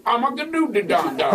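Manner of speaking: "Armageddon-dee-don-don" tumbling into extra syllables; mock cue-card fumble on "Armageddon-dee-don-don"; botched pronunciation. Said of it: "If you could pronounce the movie".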